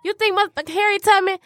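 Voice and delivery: high and loud, triumphant cry; mock heroic yell to freedom; hammed-up heroic shout. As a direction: shout it like leading the escape to freedom. Dramatic Harriet Tubman escape shout.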